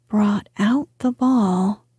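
ordinary delivery, nothing marked

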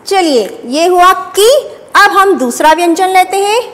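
ordinary delivery

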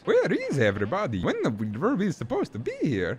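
high, swooping cartoon Italian voice; puzzled high-pitched game sidekick; Luigi, puzzled. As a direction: wonder aloud as a cartoon plumber.

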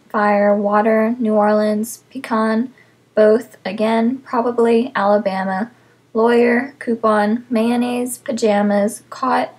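ordinary talk, nothing marked

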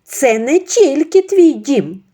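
high, loud, slow cry; exclaimed. Acted. Mother-in-law crying out to Ksenia about the house. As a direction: protest loudly. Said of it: "вигукнула свекруха".

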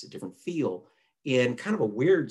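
usual speaking voice

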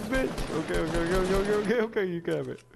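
loud, rapid frantic okays; frantic shouting. Real, not acted. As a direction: yell it out to calm him down. Teammate arguing over the RPG.

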